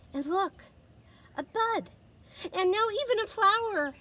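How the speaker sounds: high and loud, rising delight; delighted wonder. A narrator's reading. Reading to children, marveling at the flower. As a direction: show them the bud then the flower with joy.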